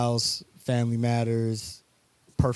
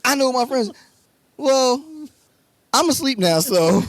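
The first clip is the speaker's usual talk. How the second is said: mock dismissive reply; 12 semitones higher, 6 dB louder than usual. Acts out the argument's goodnight.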